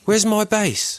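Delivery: bewildered musician. Retold or imitated. Confused musician quoted.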